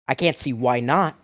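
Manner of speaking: ordinary delivery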